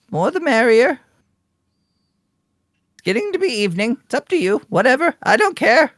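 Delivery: high-pitched, chatty grandma; hospitable old woman. Acted.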